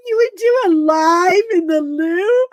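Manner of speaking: high loud laughing question. Retold or imitated. Asking the friend about the loo live, laughing. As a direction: cry it out amazed. First take of repeated quote.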